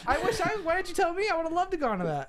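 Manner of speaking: high-pitched, envious disbelief; dismayed protest. Real, not acted. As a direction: protest at being left out, pitch way up. Learns he missed the celebrity screening.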